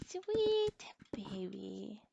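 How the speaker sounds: put-on slow hushed coo to a baby; tender whispered baby talk. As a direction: breathe it softly like over a crib. Cooing over game baby.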